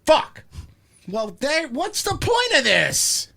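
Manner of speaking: high-pitched comic yell of frustration; exasperated outburst. Pretend. Host hearing housing went up again, exasperated yell. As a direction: blow up in mock despair. Mock outburst at rising house prices.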